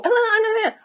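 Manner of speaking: high, soft mock whimper; squeaky stammered protest noise; whiny objection noise. Coach mimicking a client's guilty twinge, mock whining. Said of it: "if you have a little".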